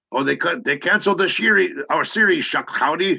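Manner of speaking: high, loud cowboy show-host voice; Punch. Acted. TV cowboy host announces series cancellation, in high loud character voice. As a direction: holler it in an over-the-top cowboy act. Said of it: "the scene you're talking about is".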